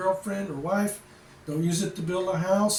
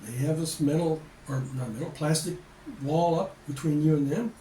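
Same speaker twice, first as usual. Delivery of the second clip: ordinary delivery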